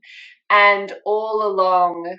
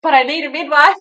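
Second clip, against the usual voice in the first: high, loud, hammed-up desperate plea; stressed pleading; 9 semitones higher, 8 dB louder, faster than usual. Told to calm down by the midwife, stressed pleading. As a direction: imitate a frantic protest, pitched right up. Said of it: "I was so stressed about this".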